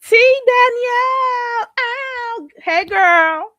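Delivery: high, loud, drawn-out name call; excited greeting. Real, not acted. Welcoming a viewer who joined the stream, delighted call. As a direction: sing out her name in delight.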